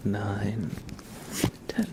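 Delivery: ordinary delivery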